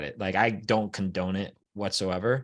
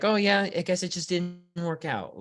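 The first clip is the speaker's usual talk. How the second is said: mock shrug; 7 semitones higher than usual. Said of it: "it's just like".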